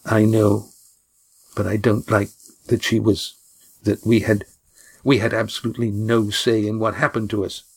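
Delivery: words pushed through clenched teeth. Acted. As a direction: hold anger behind clenched teeth. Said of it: "replied through clenched teeth".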